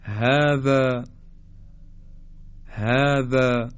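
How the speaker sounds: "هذا" slowly, opening on an airy h; open round h. Shown as a demonstration. Teacher models هذا with unconstricted ه. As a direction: start "هذا" with free breath, throat loose. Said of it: "پس های دو چشم مثل".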